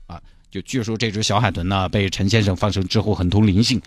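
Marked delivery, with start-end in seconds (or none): none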